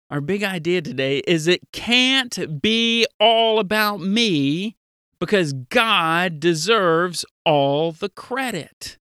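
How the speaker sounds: usual speaking voice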